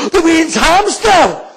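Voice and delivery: high, drawn-out, very loud shriek; horrified scream. Retold or imitated. Woman whose child's hamster was just kicked, horrified scream. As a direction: shriek it in comic horror.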